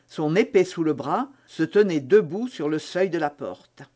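usual speaking voice

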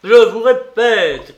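loud, high outburst, words stretched; exploding with anger; furious shout. A narrator's reading. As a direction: shout it slowly in fury. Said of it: "cria Raskolnikov furieux".

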